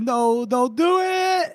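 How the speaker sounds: high, drawn-out plea; mock pleading wail. Hungover man begs her not to open window, mock wail. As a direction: wail it slowly, hamming up the dread.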